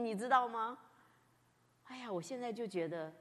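ordinary talk

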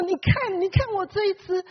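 a hint of a proud owner; proud shepherd. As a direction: show off the found sheep, pleased. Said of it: "那么得意说".

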